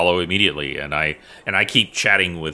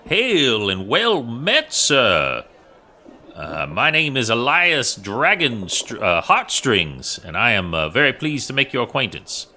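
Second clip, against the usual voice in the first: in-character greeting; 7 semitones higher than usual. Acted. Player speaks in character to minotaur.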